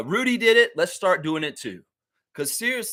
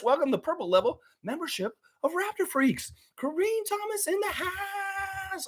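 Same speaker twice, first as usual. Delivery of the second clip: hype announcer shout; 13 semitones higher, 6 dB quieter than usual. Acted. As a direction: boom it out like welcoming a fighter to the ring. Hyping a new channel member.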